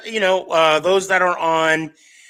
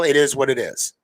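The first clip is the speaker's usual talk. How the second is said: ordinary talk, nothing marked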